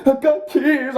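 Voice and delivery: high-pitched whiny pleading, hammed up; mock tearful plea. Defendant begging the judge for mercy, mock tearful plea. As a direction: plead in a high fake-crying voice.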